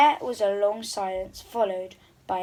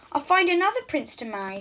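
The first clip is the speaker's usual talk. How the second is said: mild snap; 6 semitones higher, faster than usual. Acted. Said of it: "she snapped".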